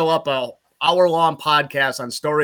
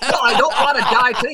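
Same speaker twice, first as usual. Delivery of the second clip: fast, higher answer through a laugh; laughing panic; 7 semitones higher, 4 dB louder, faster than usual. Retold or imitated. Scared flyer answering teammate during turbulence, laughing alarm. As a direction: blurt it out half laughing.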